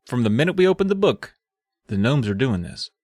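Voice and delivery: usual speaking voice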